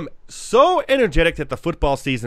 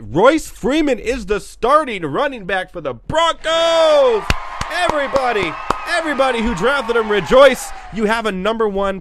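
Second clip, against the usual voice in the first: hyped shouting news call, higher and louder; excited announcement; 8 semitones higher, 4 dB louder than usual. Acted. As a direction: shout it like a sports anchor going wild.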